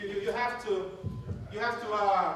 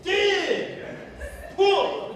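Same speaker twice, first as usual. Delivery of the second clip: barking orders; 9 semitones higher, much slower than usual. Retold or imitated. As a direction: bark out tug-of-war orders. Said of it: "I like to bark orders".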